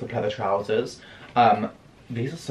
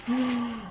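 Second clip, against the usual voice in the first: quick gasp; 11 semitones higher, 7 dB quieter than usual. Real, not acted. Admiring the jumper.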